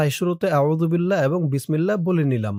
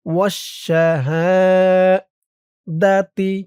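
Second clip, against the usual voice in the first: very slow chant, higher and louder; tajweed recitation; 4 semitones higher, 4 dB louder, much slower than usual. Shown as a demonstration. Teacher showing where to stretch each sound. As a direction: draw out ইলাহা and ইল্লা, stop on হু. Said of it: "এখান থেকে আমার সাথে বলুন".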